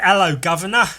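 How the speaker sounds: a light, hammed-up cockney hello; mock cockney greeting. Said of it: "he said".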